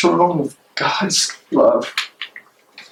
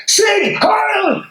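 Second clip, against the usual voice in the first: much higher mock Nazi salute cry; Sieg Heil shout. Bonhoeffer mocking cowed theologians saluting, high shout. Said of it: "raise their hand meekly and say".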